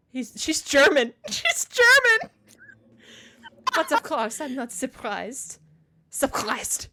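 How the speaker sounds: hammed-up German accent, slow and loud, breaking into laughs; mock German accent. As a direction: try a silly German accent and crack up. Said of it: "I'm offending the Germans".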